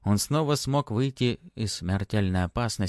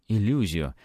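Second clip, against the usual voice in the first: ordinary delivery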